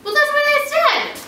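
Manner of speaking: squeaky indignant retort; high-pitched defensive. Real, not acted. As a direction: shoot back way up high.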